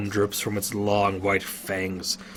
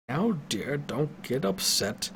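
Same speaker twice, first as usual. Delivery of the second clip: put-on old wizard; 4 semitones higher, 5 dB quieter than usual. A narrator's reading. Old wizard soothes Lydia.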